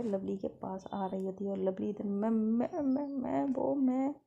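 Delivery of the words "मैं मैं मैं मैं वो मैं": higher, halting scared stammer; nervous stammer. Acted. Girl caught after the pillow throw, stammering nervously. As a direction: stumble over words, caught out.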